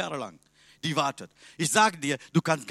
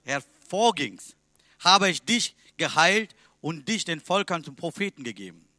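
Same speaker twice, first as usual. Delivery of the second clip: solemn scripture reading; 2 semitones higher, 5 dB louder than usual. Acted. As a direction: proclaim the verse to the congregation. Reads the Bible verse aloud. (feierliche Bibellesung, laut und langsam)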